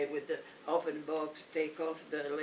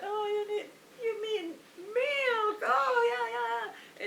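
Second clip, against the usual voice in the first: high mock clerk; 5 dB louder than usual. Clerk finally grasps the word, squeaky high mock voice.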